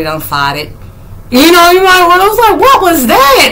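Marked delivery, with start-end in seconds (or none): 2.6-3.5 high-pitched mock disbelief; startled reaction. Recalling a sudden awful smell in the room, high startled voice. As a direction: act out a shocked question, pitched high.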